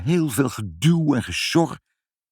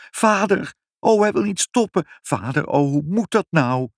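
high-pitched mounting alarm; alarmed cry; 10 semitones higher than usual. A narrator's reading. Mother cries to father as machine runs on, alarmed.

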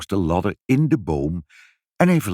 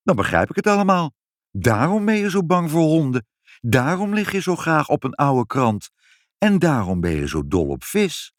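high-pitched, eager run of realisations; unfazed child; 7 semitones higher than usual. A narrator's reading. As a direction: piece it together brightly, not shocked at all. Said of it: "niet in het minst geschrokken".

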